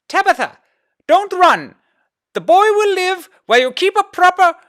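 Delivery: high loud scolding mother; scolding shout. A narrator's reading. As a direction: shout after her, scolding. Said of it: "her mother scolding".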